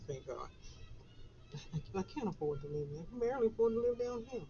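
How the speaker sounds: ordinary delivery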